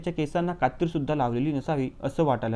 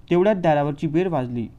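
ordinary delivery

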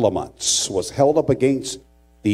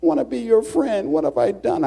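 high, wounded put-on voice; mock an innocent boy protesting; high-pitched imitation of a bewildered victim; pleading classmate; 10 semitones higher than usual.

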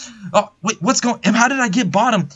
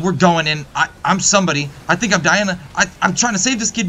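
ordinary delivery

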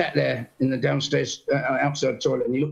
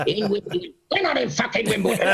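high, laughing mock indignation; rising to a laughing protest; an old star's confused outburst, laughing through it; laughing mock outrage; 7 semitones higher than usual.

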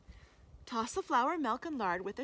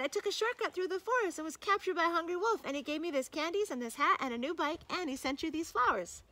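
put-on high, breathless child's report; excited cry; 7 semitones higher, 3 dB louder than usual. A narrator's reading. Boy telling his mother his adventure, high and excited. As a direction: rattle off the news in a boy's voice. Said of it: "he cried".

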